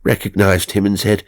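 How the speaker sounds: plain reading voice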